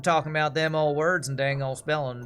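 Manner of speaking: loud mock country voice; hammed-up Southern drawl. Gamer mocking German spelling in a hammed-up Southern accent. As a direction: lay on a hillbilly twang. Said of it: "Makes me think of that".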